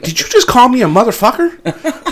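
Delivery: high, loud incredulous question; stunned she heard that right; shocked disbelief. Retold or imitated. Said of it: "I was like".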